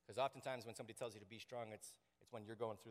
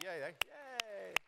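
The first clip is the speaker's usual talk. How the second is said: slow, higher mock cheer; 9 semitones higher, 4 dB louder, slower than usual. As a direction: lead the crowd in a drawn-out fake cheer. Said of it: "You could clap".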